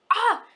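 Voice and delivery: high, loud burst of "ah!"; exasperated cry. Real, not acted. Fan torn over problematic books, exasperated cry. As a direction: cry out, lost for words.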